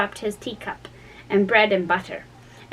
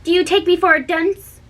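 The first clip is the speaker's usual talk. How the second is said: raised, impatient king; sharp; 8 semitones higher, 3 dB louder than usual. A narrator's reading. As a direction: scold him for wasting time. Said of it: "said the King sharply".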